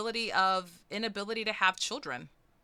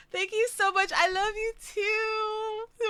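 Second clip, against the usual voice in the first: high, tearful squeal of thanks; stretched-out, high emotional thanks; overwhelmed gratitude; 13 semitones higher, slower than usual. Real, not acted. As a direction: squeal it, close to happy tears.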